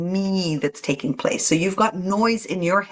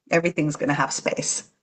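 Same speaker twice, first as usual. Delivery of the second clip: usual speaking voice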